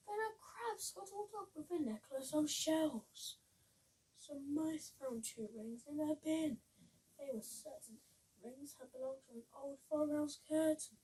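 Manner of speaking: plain reading voice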